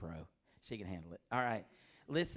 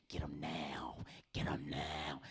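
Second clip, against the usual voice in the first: eerie low hush; 11 semitones lower, 4 dB quieter, much slower than usual. Retold or imitated. Ominous retold warning.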